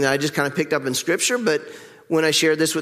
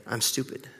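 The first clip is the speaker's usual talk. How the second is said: inner voice muttering; 4 semitones lower, 10 dB quieter than usual. Retold or imitated. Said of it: "our inner voice would say".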